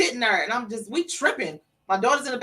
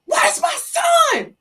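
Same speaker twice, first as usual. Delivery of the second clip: very high, loud, stretched-out wail; desperate scream; 6 dB louder, much slower than usual. Retold or imitated. Mother on the phone to son's crying friend, screaming. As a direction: scream it out long in anguish.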